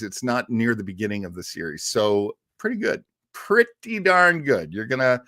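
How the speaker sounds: ordinary talk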